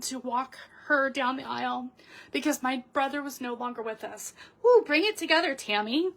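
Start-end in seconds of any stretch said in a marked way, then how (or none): 4.6-6.1 higher, louder woo to shake off tears; rally yourself out loud after tearing up; brisk, bright self encouragement; self pep talk. Real, not acted. Pulls herself together, self talk.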